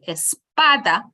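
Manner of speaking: espada with the middle syllable PA stressed. Shown as a demonstration. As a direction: lean firmly on PA in espada. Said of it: "we place a stress on the pa".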